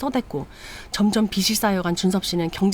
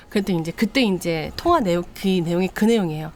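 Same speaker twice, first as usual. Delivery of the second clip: usual speaking voice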